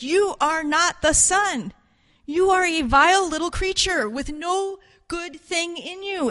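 loud, high denunciation; forceful preaching. Acted. The cross reveals the sinner's state, forcefully denouncing.

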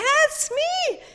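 giddy delight. Acted. Rahab claiming the sound as hers, squealing with delight. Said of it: "She's like".

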